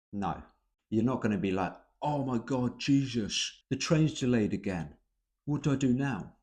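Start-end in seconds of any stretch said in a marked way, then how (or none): 2.0-6.4 higher, louder overblown panic; dramatic panic. Shown as a demonstration. Teacher showing the wrong way to complain, hammed-up panic. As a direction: make it a mock catastrophe. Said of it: "not dramatic".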